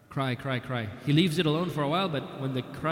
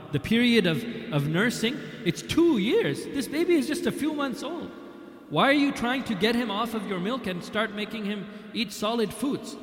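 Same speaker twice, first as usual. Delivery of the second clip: put-on astonished protest at the young mother; surprised and indignant; 8 semitones higher than usual. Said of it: "And then he's surprised".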